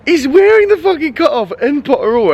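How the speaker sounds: rising to a disbelieving squeal; excited disbelief. Retold or imitated. Retelling shocking moment, voice jumps.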